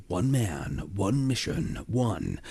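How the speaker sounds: quiet, understated voice-over tagline. Shown as a demonstration. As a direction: voice the tagline softly, held back. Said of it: "Sometimes it's just".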